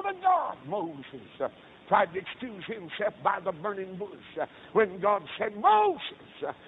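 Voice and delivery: rhythmic pulpit delivery with sudden high shouts; impassioned revival preacher; fervent preaching. Acted. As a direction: preach the story, hollering the key words. Preaching at full force.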